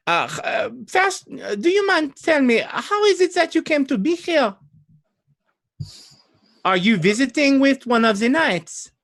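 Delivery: put-on very high, inquisitive French-accented lady. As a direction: quiz the visitor in a high, heavy French accent. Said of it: "French in this case would be Old Adari".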